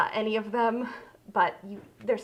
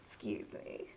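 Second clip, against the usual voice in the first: whispered aside; 14 dB quieter than usual. Real, not acted. Quiet aside while struggling.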